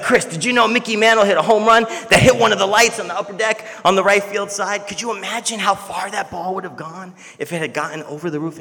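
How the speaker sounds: high and loud, rushing with wonder; excited dad. Retold or imitated. Dad telling his son a Mickey Mantle story, excited. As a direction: marvel like a boy at a ballgame. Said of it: "he's like".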